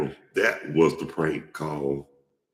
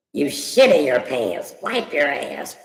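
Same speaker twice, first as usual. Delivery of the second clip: much higher, louder, slow put-on voice; prank message voice; 11 semitones higher, 6 dB louder than usual. Prankster giving the victim his message, high slow put-on voice.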